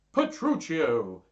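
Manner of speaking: big slow exclamation; exclaimed greeting. Acted. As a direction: hail an old friend in surprise. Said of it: "exclaimed Hortensio".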